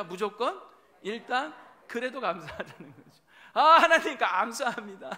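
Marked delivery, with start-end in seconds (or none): 3.5-5.2 loud laughing praise to God; laughing thanks. Acted. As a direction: burst out in joyful gratitude.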